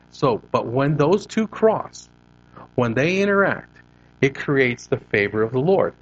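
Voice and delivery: ordinary delivery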